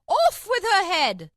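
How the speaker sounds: high, drawn-out royal yell; top-of-voice shout. A narrator's reading. Queen orders Alice's execution, shouting at full voice. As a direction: shout the order with all your might. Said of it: "shouted at the top of her voice".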